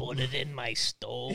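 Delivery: low, quiet, slow voice-box drone; deadpan voice-box imitation; stoma voice. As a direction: mimic a robotic throat-stoma voice. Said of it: "quoting film line".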